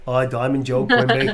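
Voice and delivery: bad JFK-style accent; Diamond Joe Quimby. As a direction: do a bad Kennedy-esque politician. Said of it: "I like doing, uh, Diamond Joe Quimby".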